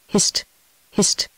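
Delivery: lightly hushed "hist", twice; short, quiet call for silence; narrator's understated whisper to a companion; whispered warning. A narrator's reading. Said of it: "whispered his companion".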